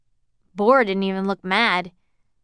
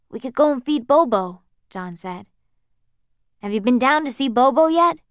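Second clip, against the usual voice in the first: higher, louder, bright coaxing; coaxing cheerfulness; 5 semitones higher, 4 dB louder than usual. Acted. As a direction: try to cheer him up. Coaxing lonely old man cheerfully.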